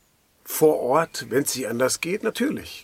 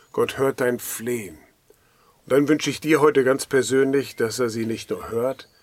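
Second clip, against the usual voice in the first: ordinary delivery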